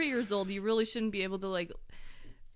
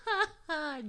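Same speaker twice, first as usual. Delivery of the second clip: high mock belly laugh; put-on high ha-ha-ha; imitated belly laugh; 8 semitones higher than usual. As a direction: mimic a child's big laugh, pitched up. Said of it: "belly laughs".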